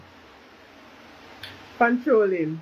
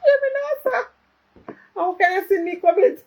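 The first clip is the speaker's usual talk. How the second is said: high, loud mock scolding; high playful telling-off; 11 semitones higher, 4 dB louder than usual. Real, not acted. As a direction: tease the little one who walked in. Scolding the child who walked in.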